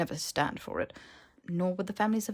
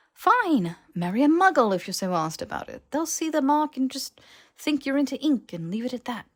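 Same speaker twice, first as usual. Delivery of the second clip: exasperated outburst; 8 semitones higher, 5 dB louder than usual. Acted. Harry exasperated, throws up hands.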